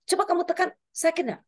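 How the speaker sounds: retold anxious check on a child; worried questioning. Mother examining child's lump, retold.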